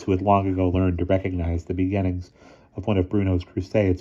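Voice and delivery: ordinary delivery, nothing marked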